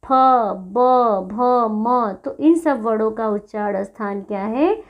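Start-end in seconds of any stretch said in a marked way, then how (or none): 0.0-2.1 "फ, ब, भ, म" spoken singly, lips pressed together; labial articulation. Shown as a demonstration. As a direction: demonstrate "फ, ब, भ, म" as separate lip sounds. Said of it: "दोनों ओंठ आपस में क्या हो रहे हैं".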